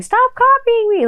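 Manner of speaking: put-on very high kid voice; mock child taunt; childish whine. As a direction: squeak it like a little kid. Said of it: "anyone really saying".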